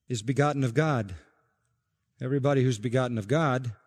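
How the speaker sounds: normal talking voice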